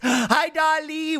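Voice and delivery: high, drawn-out put-on greeting; gasp, then sing out the hello; hammy high character voice; Harry. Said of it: "Yeah, my Harry voice".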